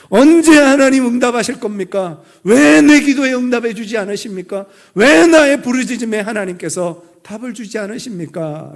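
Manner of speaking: loud, high cry to God. Acted. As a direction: call out an anguished prayer, demanding an answer. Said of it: "외쳤을 때".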